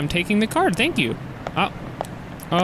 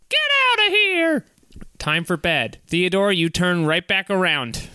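usual speaking voice

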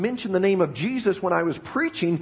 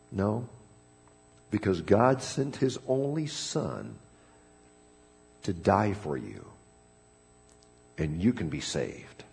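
much lower, soft and slow; hushed earnest invitation; tender appeal; 8 semitones lower, 6 dB quieter, slower than usual. Acted. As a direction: gently offer hope.

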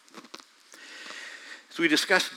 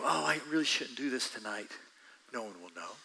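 softer sly self-talk; tempting inner voice; 3 semitones lower, 10 dB quieter than usual. Acted. Preacher voicing the tempted inner thoughts, softer. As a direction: coax yourself quietly.

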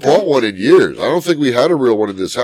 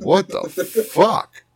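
mock disbelief; 5 semitones higher, 5 dB quieter, slower than usual. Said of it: "I'm like".

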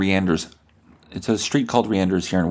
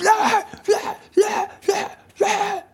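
high, yappy barks like a spooked dog; imitated dog barking; slower than usual. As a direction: bark it out like a frightened little dog. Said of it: "And the dog was like".

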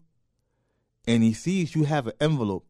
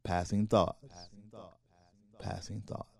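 softer each time, lower; softer, lower fade-out; fading sign-off; 6 semitones lower, 16 dB quieter than usual. Acted. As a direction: let the title fade away. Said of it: "sign-off repeated, fading".

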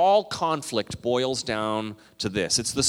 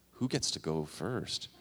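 hushed wondering; 6 semitones lower, 10 dB quieter than usual. Retold or imitated. Everyone in line wondering.

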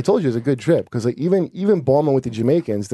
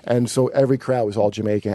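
normal talking voice

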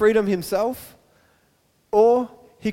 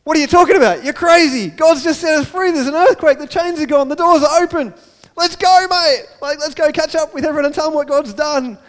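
high, loud, urgent protest; excited Silas; 7 semitones higher, 7 dB louder than usual. Acted. Silas arguing with Paul to leave jail, excited and loud. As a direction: urge your mate on, loud and excited. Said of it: "And Silas is so excited".

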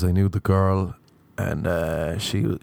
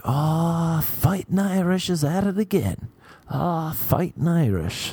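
high-pitched mock jeer; sing-song teasing in a higher pitch; teasing bouncer; 8 semitones higher, slower than usual. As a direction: imitate a bouncer ribbing someone, drawn out at the end. Mimics bouncer teasing him.

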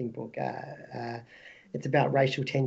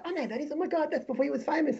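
surprised recognition; 11 semitones higher than usual. Retold or imitated. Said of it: "that you say".

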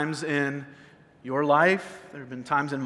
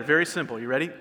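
ordinary talk, nothing marked

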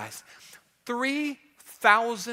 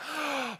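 gasp coming up from water; 4 semitones higher, 10 dB quieter than usual. Retold or imitated. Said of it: "you come up and you're like".

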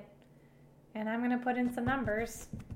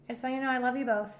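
ordinary delivery

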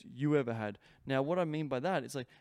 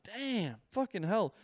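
higher, louder amazed curse; mock stunned swearing, higher pitch; overwhelmed exclaim; 7 semitones higher, 3 dB louder, slower than usual. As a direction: blurt out in awe. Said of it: "I was like".

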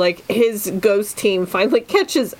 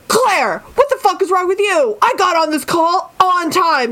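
mock defensive shout; 6 semitones higher than usual. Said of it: "people screaming at each other".

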